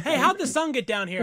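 high puzzled mock question, a touch of a laugh; nitpicky viewer. Host mocking a confused viewer, puzzled high voice. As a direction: ask it like a confused pedant.

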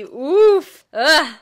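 loud oof and ugh; pained groan. Real, not acted. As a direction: wince out loud. Reacting to Italians scoffing.

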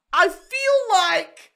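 high, slow, drawn-out wail; mock wail. Real, not acted. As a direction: stretch each word into a high whine. Wailing before giving up the topic.

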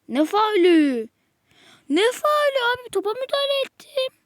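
from a loud, indignant question to a high, loud complaint; real disbelief at the foul; outraged protest. Real, not acted. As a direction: protest the ref's call, rising as you go.

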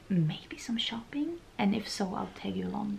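unvoiced whisper; quiet confiding whisper. Real, not acted. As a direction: whisper it as an aside. Whispered aside about plans.

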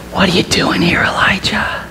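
whispered question, fading to breath; God whispering to Elijah. Retold or imitated. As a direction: ask softly, barely voiced.

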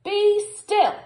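high, loud stern order; commanding shout. Acted. Max orders the wild things to be still, commanding shout. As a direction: shout at the monsters to freeze. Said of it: "Till Max said".

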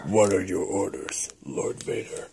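very low, hushed, almost whispered; Boba Fett voice. Acted. Bounty hunter asking Lord Vader for orders, in a low hushed voice. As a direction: drop into a deep, soft henchman's rasp. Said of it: "do your Boba voice".

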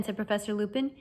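usual speaking voice

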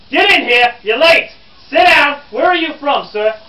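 loud, high scolding shout; barked orders. Acted. Reporter ordering a late classmate to sit, shouting. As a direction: order the latecomer in at full volume. Berates a latecomer in character.

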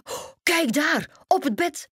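high gasp and exclamation; gasp, then point it out in high-pitched shock; startled. A narrator's reading. Doc spots someone on the bed, startled. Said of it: "zei Doc geschrokken".

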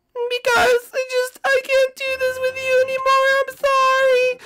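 high-pitched princess, hurt and firm; Daisy's high voice. Acted. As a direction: pitch way up and shut him down.

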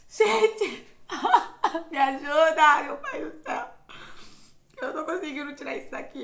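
high, loud laughing cry for help; laughing plea. Real, not acted. Stuck peeling the mask, laughing plea for help. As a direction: beg for help through laughter.

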